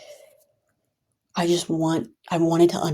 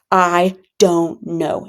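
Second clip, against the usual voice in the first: very slow, louder I don't know; drawn-out refusal; 5 dB louder, much slower than usual. Retold or imitated. Friend refusing to hang out, slow and drawn out. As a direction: stretch every word of I don't know.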